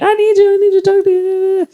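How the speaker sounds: hammed-up pleading in a high voice; clingy friend. Mimicking a clingy, needy friend.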